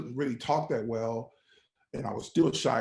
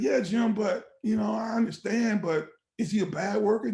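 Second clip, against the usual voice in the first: higher-pitched, questioning union steward; raised-pitch mock objection; retold reluctant objection; 7 semitones higher, 4 dB louder than usual. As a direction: gently push back on the boss, imitated. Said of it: "They're just talking like this".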